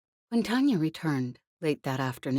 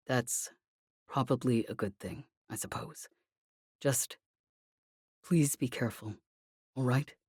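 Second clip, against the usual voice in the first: calm but strained; 3 semitones lower, 4 dB quieter than usual. A narrator's reading. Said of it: "his voice was calm, if strained".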